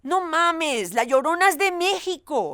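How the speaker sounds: high, sure Mexican voice; Mexican accent. Acted. Mexican settling the argument, smug Mexican accent. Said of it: "every country has their own version".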